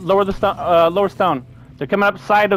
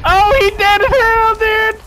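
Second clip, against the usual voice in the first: excited outburst; 12 semitones higher, 5 dB louder, much slower than usual. Real, not acted. Teammate's shot lands, player erupts.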